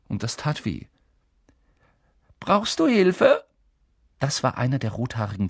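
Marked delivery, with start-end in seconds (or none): 2.4-3.4 cheerful twin. A narrator's reading. Twin offering help.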